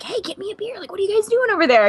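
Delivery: playful mock call across the room; Tom Hanks. Star jokes with the women at the bar, lightly imitated. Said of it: "Tom Hanks looks over at us again".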